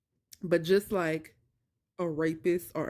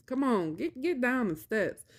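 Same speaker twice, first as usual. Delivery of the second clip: higher, hurry her down; impatient urging; 6 semitones higher than usual. Retold or imitated. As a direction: come on, get down already.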